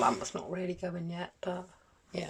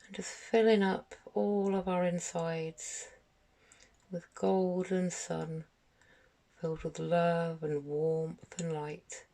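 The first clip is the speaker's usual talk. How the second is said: guided meditation; 3 dB quieter than usual. Acted. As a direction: gently guide them through each breath, unhurried, letting phrases settle. Leads a guided meditation for children.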